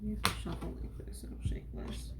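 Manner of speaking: soft whispered aside; whispering. Real, not acted. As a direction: whisper it under your breath. Quiet aside while shuffling cards.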